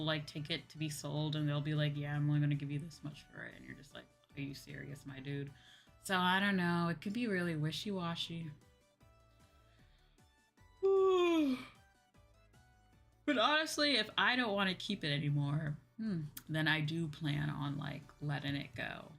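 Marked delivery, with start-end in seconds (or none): none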